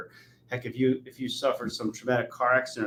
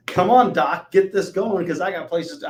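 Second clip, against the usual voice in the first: impatient demand; 7 semitones higher, 7 dB louder than usual. Retold or imitated. Demanding a fix from the doctor.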